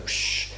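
soft hissing whoosh; imitated whoosh. Preacher mimics a water superpower, soft whoosh. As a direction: make a quiet rushing-water sound. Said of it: "they can kind of go".

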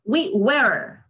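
American "We were" with the R sounded; American accent. Shown as a demonstration. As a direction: say "We were" the American way, finishing on a clear R. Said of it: "Aquí sí se pronuncia la R".

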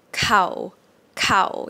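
Thai "cow" said on a low tone; low, level "cow" in Thai. Shown as a demonstration. Teacher models the low tone for students. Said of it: "It's a low tone".

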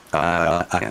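ordinary delivery, nothing marked

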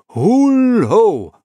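loud, high drawn-out hail; calling out. A narrator's reading. Someone hailing from the bar, calling out. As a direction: sing it out across a room.

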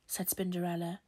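ordinary delivery, nothing marked

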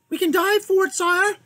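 a brave little mouse offering his service; gallant mouse. Acted. The mouse knight offers to dive for the king, high and eager.

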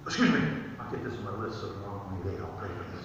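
low, soft, rushed mumble; mock muttered aside. Mocking putting prayer off till tomorrow's list, muttered. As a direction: mutter it quickly as if to yourself.

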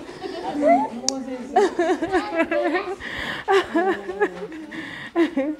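long, high fit of laughing; real laughter. Real, not acted. Among the children asking for hugs, long high laughter.

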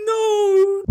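a high, loud wailing no; anguished cry. Real, not acted. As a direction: cry it out in agony. Anguished reaction to the match.

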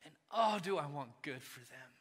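soft, sincere father's love; hushed tender confession; tender and quiet. Real, not acted. Tender talk about his kids.

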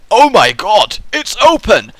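high, loud delighted cry; thrilled viewer at the gap opening; excited. Pretend. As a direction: gush at the opened door. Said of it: "then you're like".

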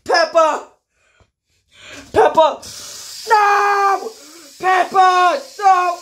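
high, loud calls and cries; desperate calling. Acted. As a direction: shout after her as she leaves. Toy calls desperately after Peppa.

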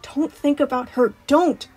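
self-scolding. Acted. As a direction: scold yourself into cheering up. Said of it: "he kicked himself".

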